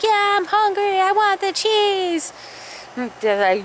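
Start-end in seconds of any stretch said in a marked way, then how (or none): 0.0-2.3 put-on high, slow whiny kid voice; a hungry little kid whining for cheese.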